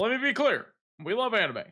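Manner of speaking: loud, high, hammed-up speech voice; mock presidential. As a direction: ham up a political address. Obama impression bit.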